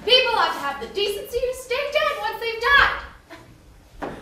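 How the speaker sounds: rising, loud, high exasperation; exasperated. Acted. Ruler fed up with human melodrama, exasperated. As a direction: rant in regal irritation.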